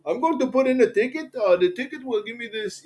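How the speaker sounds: high-pitched mock support agent; customer service agent. Retold customer service agent's reply.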